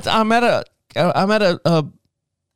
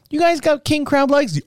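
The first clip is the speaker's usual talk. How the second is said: high mock texting voice; chirpy question pitched way up; asking a shady seller; 13 semitones higher than usual. To a Facebook seller, a high mock question. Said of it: "I'm like going".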